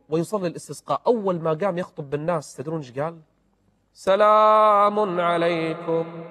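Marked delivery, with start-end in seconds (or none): 3.9-6.2 very slow, drawn-out chanted سلام عليكم, voice faltering; choked greeting. Acted. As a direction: stretch سلام عليكم slowly, then break off. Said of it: "وسكت وأخذ يبكي". (سلام ممدود متهدج قبل البكاء)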